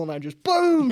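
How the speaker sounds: high, loud boom; imitated gunshot. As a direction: punch the shot sound. Imitating his own shot.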